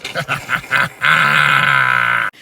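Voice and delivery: imitated helpless cackling; long, hammed-up fit of laughter; crying laughing. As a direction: laugh like friends rolling on their backs. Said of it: "they're crying laughing".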